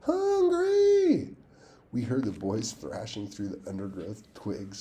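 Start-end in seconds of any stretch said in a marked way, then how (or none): 0.0-1.4 very high, loud ghostly shriek. A narrator's reading. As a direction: shriek to scare them away. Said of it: "you shrieked".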